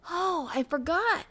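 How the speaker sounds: high, drawn-out startled exclamation; mildly frightened. A narrator's reading. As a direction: catch yourself with a touch of alarm. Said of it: "feeling frightened".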